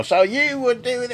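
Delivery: high, brash Cockney voice for the rude bloke; Cockney accent. Retold or imitated. Tim retells the rude bloke's comeback in a Cockney accent. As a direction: snap back like a cheeky Cockney lad. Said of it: "changing his accent to".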